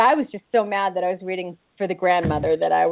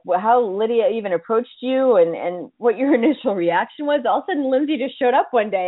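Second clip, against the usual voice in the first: ordinary talk, nothing marked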